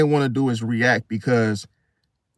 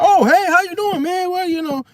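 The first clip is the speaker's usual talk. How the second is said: much higher, louder, giddy put-on voice; overly cheerful squeaky hello; giddy greeting; 3 dB louder than usual. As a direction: gush at the neighbor all hyped up. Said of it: "a little bit more giddy".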